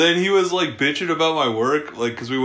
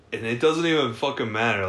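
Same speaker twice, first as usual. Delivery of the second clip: usual speaking voice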